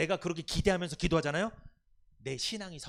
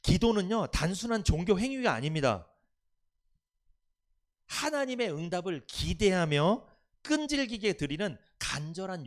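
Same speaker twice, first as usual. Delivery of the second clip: preaching; 5 dB louder than usual. Acted. Pastor exhorting congregation.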